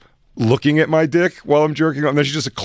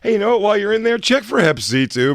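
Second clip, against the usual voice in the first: high breezy mock request; 10 semitones higher, 5 dB louder than usual. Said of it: "'cause I would go".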